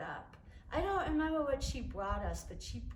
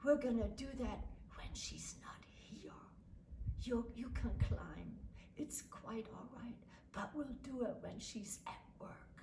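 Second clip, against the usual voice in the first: put-on hushed secret whisper to a child; father whispers a secret plan to his daughter; conspiratorial whisper; 9 dB quieter than usual. He winked at me and he said.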